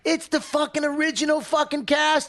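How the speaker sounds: mock excited fan. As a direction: squeal like a thrilled listener. Mock excited listener hearing the podcast.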